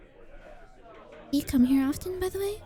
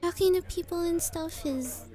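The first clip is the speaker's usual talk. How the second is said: nervous laugh; 7 semitones higher than usual. Acted. Shy admission of difficulty.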